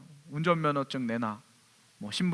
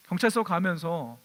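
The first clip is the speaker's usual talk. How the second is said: ordinary talk, nothing marked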